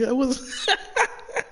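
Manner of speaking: high sobbing laugh; crying laugh. Real, not acted. Streamer caught off guard, high sobbing laugh. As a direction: laugh that tips into a sob.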